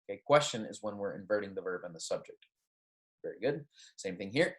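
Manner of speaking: normal talking voice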